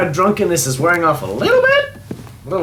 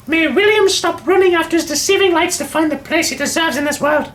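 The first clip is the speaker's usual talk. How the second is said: higher, proud and scornful lady; stern aristocratic mother. Acted. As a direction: lay down the law like a cold matriarch. Reads the mother's diary in character.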